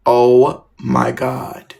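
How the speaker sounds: slow, stretched-out dismay; drawn-out dismay. Retold or imitated. Student reacting to the principal's talk, slow dismay. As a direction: draw each word out, dreading what comes.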